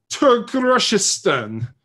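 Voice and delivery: high, loud and slow, announcing a fake country; slow hammed-up announcement; mock grand name. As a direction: drag it out like a movie villain's homeland.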